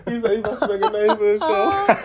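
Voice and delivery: repeating it through real laughter; laughing. Real, not acted. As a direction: tease him while laughing. Friend mocks line laughing.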